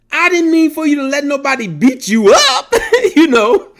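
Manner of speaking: laughing. Real, not acted. A father laughing as he recalls his parenting slip. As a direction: chuckle it out at your own younger self.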